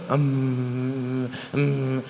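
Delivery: quiet closed-mouth mumble, mimicking timid congregants; mock mumbling. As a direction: hum it under your breath like someone too shy to pray aloud. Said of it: "Y yo miro a algunos ahí".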